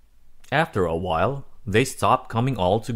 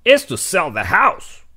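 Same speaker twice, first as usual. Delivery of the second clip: higher, louder dad voice, half joking; dad joking to mom about the teeth's cost, louder higher; 9 semitones higher, 7 dB louder than usual. Acted. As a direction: play dad cracking a grim joke. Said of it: "he joked to Mom".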